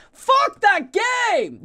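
high, slow, stretched-out yell; frustrated shout. Real, not acted. Gamer ranting about Dark Souls, slow high frustrated yell. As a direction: blast the game in a drawn-out outburst.

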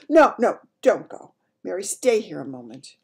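loud, urgent royal protest; urgent plea. Acted. Queen keeping the maid from leaving, urgent. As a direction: stop her firmly.

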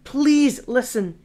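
slow pleading to stop her. Acted. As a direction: beg her gently to stop. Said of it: "he pleaded".

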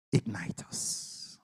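slow, hushed prayer whisper. Acted. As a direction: pray it in a whisper.

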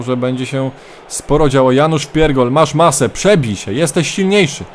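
energetic play-by-play commentator voice; live match commentary. Acted. Mock football commentary of own match.

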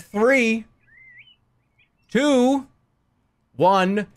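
loud, high-pitched countdown. Real, not acted. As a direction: count them down with big spaced-out beats. Host counts down to start.

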